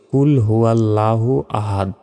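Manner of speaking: unchanted "kul huallaahu ahaad" as a wrong example; common mispronunciation. Shown as a demonstration. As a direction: say "কুল হুয়াল্লাহু আহাদ" the Bengali way, no heavy letters or bounced d. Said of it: "আমরা অনেকে ভুল উচ্চারণ করি".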